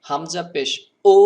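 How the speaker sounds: হামজা পেশ ও with a sharp, loud cut-off ও; ও snapped short; sudden hamza. Shown as a demonstration. (হঠাৎ করে হামজা পেশ ও)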